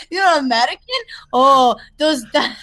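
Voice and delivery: halting mock-French accent, cracking up; cringingly overdone French accent; bad French accent. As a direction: fumble through a fake French accent. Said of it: "this is literally how it sounds".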